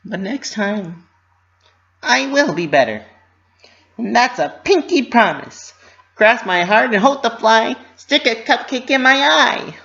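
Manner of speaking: high, bouncy cartoon pony voice; Pinkie Pie voice. A narrator's reading. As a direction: voice a cheerful pony fretting to herself.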